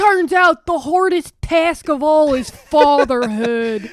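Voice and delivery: loud, slow hammed-up Delco accent; mock Delco accent. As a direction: proclaim it in broad Philadelphia-suburb speech. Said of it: "my culture is not your costume".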